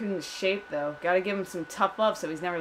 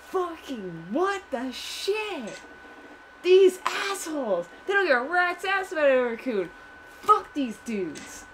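indignant outburst; 7 semitones higher than usual. Real, not acted. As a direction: rant in high-pitched outrage at the characters. Indignant at the game's characters.

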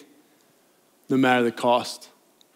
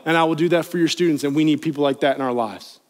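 ordinary delivery

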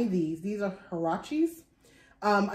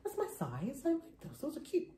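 soft musing to herself; 6 semitones higher, 9 dB quieter than usual. Retold or imitated. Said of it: "I was like".

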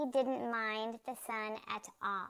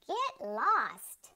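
higher, mildly annoyed telling-off; a touch of irritation, pitched up; 5 semitones higher, 4 dB louder than usual. A narrator's reading. Fat lady shooing the dog away, annoyed. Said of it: "She was very annoyed".

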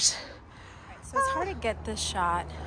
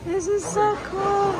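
high, drawn-out gush of delight; awed excitement; 12 semitones higher, 7 dB louder than usual. Real, not acted. Film fan marvelling at the location, excited. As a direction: gush it high, loud and slow.

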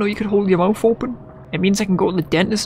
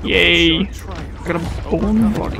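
usual speaking voice

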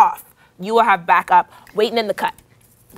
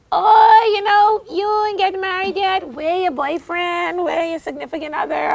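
high, slow nosy auntie; high-pitched prying family member; nosy relative; 8 semitones higher, slower than usual. Retold or imitated. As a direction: needle her like a prying relative.

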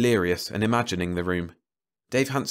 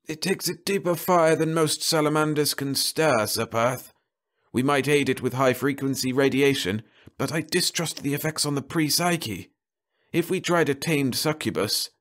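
higher, aged scholarly voice; lightly voiced old mage; older voice; 6 semitones higher than usual. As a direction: speak as an elderly sorcerer breaking in. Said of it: "an older voice broke in".

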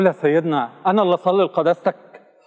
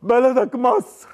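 breaking down; 9 semitones higher, much slower than usual. Acted. Husband breaking down, collapsing.

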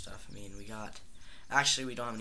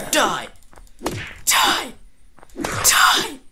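high, loud yells of die; furious yelling; 14 semitones higher, 19 dB louder, much slower than usual. Real, not acted. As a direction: scream it while striking. Fighting back, yelling.

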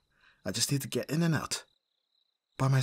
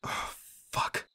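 breathless whispered oath; whispered curse; 5 dB quieter than usual. Acted. Bodyguard alone, finding the room empty: whispered curse. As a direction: swear under your breath.

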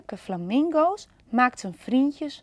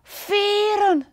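high, louder call of veren; eager cry; 10 semitones higher, 5 dB louder than usual. Acted. Panda seeing the peacock's tail, high and eager. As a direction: spot the feathers and cry out. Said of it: "riep Panda".

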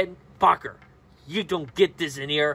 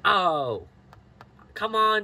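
ordinary talk

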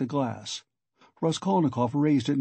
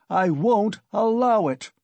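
louder, higher, drawn-out shout; indignant raised voice, words stretched; 8 semitones higher, 6 dB louder, slower than usual. A narrator's reading. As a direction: refuse in a slow, furious outburst. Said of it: "Raskolnikov shouted again".

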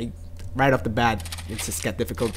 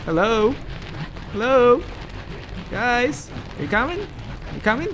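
teasing call; 9 semitones higher than usual. Pretend. Calling to enemies teasingly.

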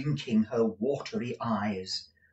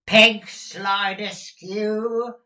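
high, long-drawn summoning call; loud call; 12 semitones higher, 10 dB louder, much slower than usual. A narrator's reading. Master summoning his deaf housekeeper, calling loudly. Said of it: "loudly repeated twice or thrice".

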